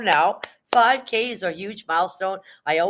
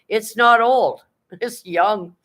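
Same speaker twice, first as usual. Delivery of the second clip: high, loud mock-indignant denial; emphatic protest; 6 semitones higher, 6 dB louder, much slower than usual. Real, not acted. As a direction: push back hard on a silly claim. Disputes viewer's claim about age.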